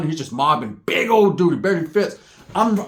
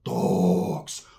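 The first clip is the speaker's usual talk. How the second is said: smoker's drawl; 10 semitones lower, 4 dB quieter than usual. Retold or imitated. Imitates Toko exhaling smoke.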